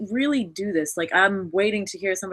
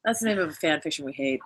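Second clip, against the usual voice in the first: ordinary talk, nothing marked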